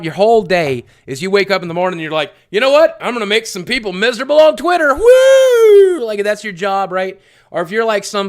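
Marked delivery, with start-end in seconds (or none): 2.5-4.9 higher, louder, fast mocking of a gleeful troll; gleeful mock troll plotting on Twitter; mock SJW voice. Mocking an SJW's morning thought. 4.9-6.0 high, loud mock whoop; hammed-up victory cry. Mock celebration after the quote.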